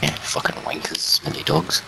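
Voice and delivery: quick hushed insult; frantic whisper. Real, not acted. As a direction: whisper it into the mic. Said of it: "a whispering man behind a microphone".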